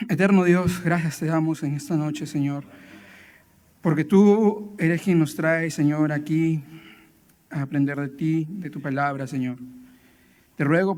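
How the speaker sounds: low, subdued prayer voice. Real, not acted. Pastor asks God for guidance before teaching, praying quietly. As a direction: pray softly and humbly to God.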